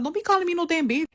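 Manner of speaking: very high, fast indignant snap; retold furious retort. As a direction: shut her insult down hard. Retold furious reply to insult.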